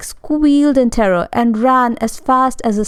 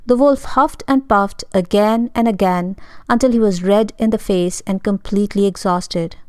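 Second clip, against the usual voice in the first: ordinary delivery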